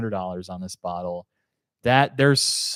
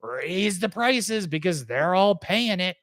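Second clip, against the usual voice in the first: greedy exec voice; 6 semitones higher than usual. Retold or imitated. Producers voiced as greedy chorus.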